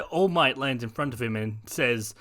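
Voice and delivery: ordinary delivery, nothing marked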